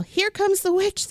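usual speaking voice

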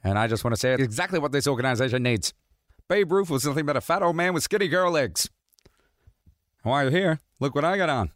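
a hint of a whiny George; George Costanza voice. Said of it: "And George is like".

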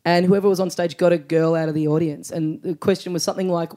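ordinary talk

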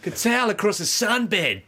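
high, hammed-up Aussie accent; Australian accent. Co-host's final attempt on request, broad Australian accent. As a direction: deliver it in broad exaggerated Australian. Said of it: "in your best Aussie accent".

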